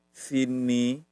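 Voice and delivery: "sini" very slowly, rising at the end; "sini" stretched as a tone model; intonation demo. Shown as a demonstration. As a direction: draw out "sini" with a lifted final syllable. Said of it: "perhatiin intonasi ya".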